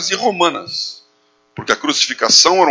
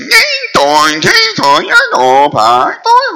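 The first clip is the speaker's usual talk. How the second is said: high, singsong imitation of Chinese tones; exaggerated tonal mock-Chinese babble; 14 semitones higher, 4 dB louder, much slower than usual. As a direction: imitate overheard Chinese with leaping pitch. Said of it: "Porque era assim".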